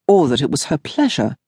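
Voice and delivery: ordinary delivery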